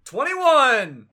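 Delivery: loud, high yell of twenty-one; shouted count. Acted. Player yelling the rocket jump count. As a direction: yell it as you launch.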